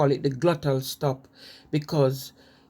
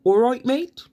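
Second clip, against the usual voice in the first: higher, louder Wolverhampton "awroight, mate"; Wolverhampton accent; 8 semitones higher, 5 dB louder than usual. Shown as a demonstration. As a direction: hail a mate in Wolverhampton style. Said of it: "Instead of saying".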